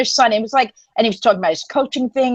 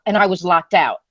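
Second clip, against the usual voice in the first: ordinary talk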